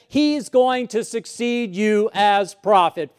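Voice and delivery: loud, high, urgent preaching; preaching crescendo. Acted. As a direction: drive the point home from the pulpit. Preaching at full force.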